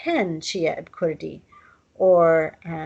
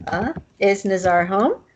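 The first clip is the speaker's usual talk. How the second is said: normal talking voice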